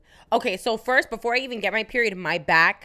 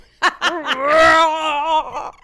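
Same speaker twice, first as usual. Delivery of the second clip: loud, high mock roar breaking into laughter; raging roar; 8 semitones higher, 8 dB louder than usual. Friend acting out period rage, playful loud roar. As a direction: let out a long playful growl of rage.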